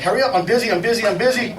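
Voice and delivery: imitated harried impatience; harried brush-off. Busy boss brushing off newcomer.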